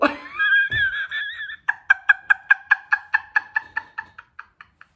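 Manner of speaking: very high, loud laughter; long helpless peals of high laughter; cracking up. Real, not acted. Laughing at turning British by accident, cracking up.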